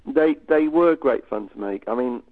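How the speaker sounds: ordinary delivery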